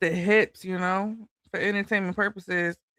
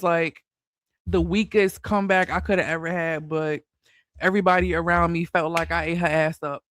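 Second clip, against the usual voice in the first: ordinary talk, nothing marked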